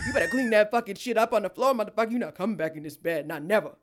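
loud hammed-up movie tough guy; Samuel L. Jackson. Ordering the man off her bed, loud mock Jackson rant. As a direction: yell it like Samuel L. Jackson in a film. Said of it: "That's me right now. Samuel L. Jackson".

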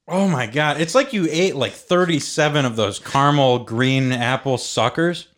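ordinary talk, nothing marked

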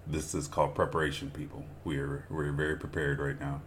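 low, soft deadpan irony; dry sarcasm. Real, not acted. Cohost joking to viewers about preparation, deadpan. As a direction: say it dryly, tongue in cheek.

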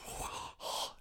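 an unvoiced sharp gasp of burning breath; gasping at strong alcohol fumes. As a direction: gasp as if spirits burn the mouth. Vapor in mouth.

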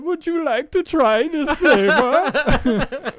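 high, loud, slow and laughing; hammed-up friendly doctor; high mocking anesthesiologist voice. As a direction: sweet-talk a kid into the gas mask, slowly. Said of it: "he said".

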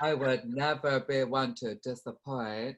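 slow, theatrical put-on voice; stately, affected delivery; Moira impression. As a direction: draw out every word grandly. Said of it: "of Moira now?".

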